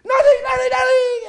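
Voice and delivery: very high, loud desperate yelling; Peter's frantic shout. Retold or imitated. Peter shouting his denial to the crowd, frantic. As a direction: scream the denial for all to hear. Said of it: "he shouted out so everyone could hear".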